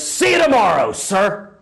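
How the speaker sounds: a sharp parade-ground farewell; crisp military sign-off. Acted. Saluting Marine answering the old man, crisp and loud.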